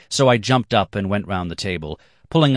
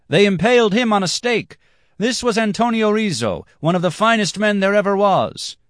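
much higher, louder indignant cry; loud outcry; 5 dB louder than usual. Acted. Dancer shouts the grim story, high and loud. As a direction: shout it over the music.

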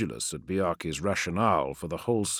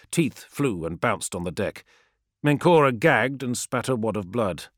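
usual speaking voice

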